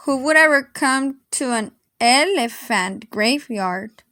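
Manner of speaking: ordinary delivery, nothing marked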